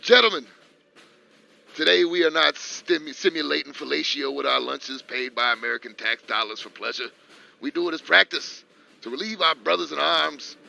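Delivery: loud, high drill sergeant address; roaring rallying speech. Pretend. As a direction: bellow it like an army commander. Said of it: "one of them army speeches".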